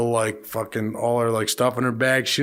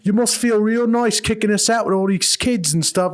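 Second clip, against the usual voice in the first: mocking traveler; 9 semitones higher than usual. Traveler taunting the enforcement guy.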